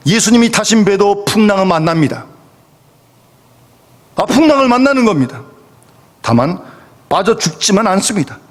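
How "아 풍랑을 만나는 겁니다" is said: ordinary delivery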